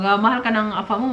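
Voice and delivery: usual speaking voice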